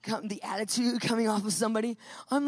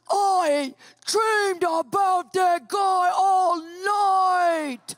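drawn-out, high, loud angry yell; furious slow shout; 7 semitones higher, 6 dB louder, much slower than usual. Retold or imitated. Husband storming out of bed shouting about the emailer. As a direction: stretch every word in outrage.

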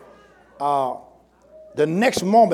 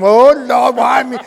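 loud, higher mock wail to God; mock crying complaint; 4 semitones higher, 8 dB louder than usual. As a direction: wail it like someone feeling sorry for themselves. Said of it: "If you don't start crying".

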